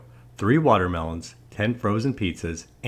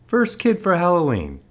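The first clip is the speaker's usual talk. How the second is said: high, excited welcome; delighted greeting; 10 semitones higher, 4 dB louder than usual. A narrator's reading. Big man opens the door to a trick-or-treater, delighted. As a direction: greet the first caller with delight.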